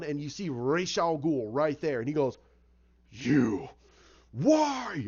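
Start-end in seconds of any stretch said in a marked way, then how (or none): 3.1-3.7 low, forceful growl of recognition; mock angry accusation. Storyteller as Batman turning to face the culprit, low anger. Batman confronts Ra's, retold. 4.3-5.0 loud, high burst on one word; acted angry demand. Storyteller as Batman confronting the culprit, angry outburst.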